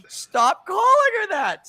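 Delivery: put-on high, slow, pleading little girl; girl's voice. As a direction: stretch it out like an upset kid. She keeps doing it.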